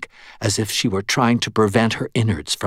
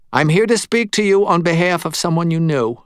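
higher-pitched voice for a reluctant medium; uneasy medium; 9 semitones higher, 4 dB louder than usual. Acted. Medium tells Murphy why he came, uneasy higher voice. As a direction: pitch it up, the medium delivering his message.

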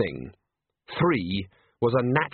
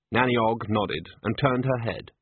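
usual speaking voice

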